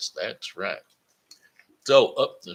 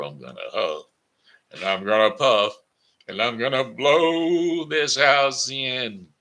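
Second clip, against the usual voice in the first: wolf threat building; 6 semitones higher, 7 dB louder than usual. Acted. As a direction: swell up as the big bad wolf.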